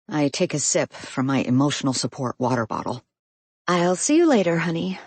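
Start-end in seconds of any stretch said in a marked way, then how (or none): 3.7-5.0 affectionate. A narrator's reading. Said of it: "repeat flatly".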